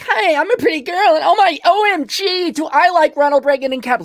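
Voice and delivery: put-on high, loud squealing ditzy girl; mock girly voice. Mocking a girly persona's talk.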